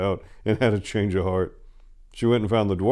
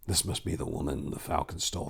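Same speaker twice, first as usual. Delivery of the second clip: hushed; 4 semitones lower, 9 dB quieter than usual. A narrator's reading. Casimir's hushed disbelief.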